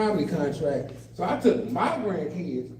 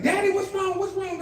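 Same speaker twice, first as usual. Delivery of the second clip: high, lightly voiced children; lightly voiced anxious children; kids worried; 11 semitones higher than usual. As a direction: ask like a worried kid.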